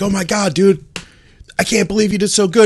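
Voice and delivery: high-pitched, amazed congratulation; retold gushing excitement. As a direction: gush at a friend in disbelief. Retold gushing congratulation after contest.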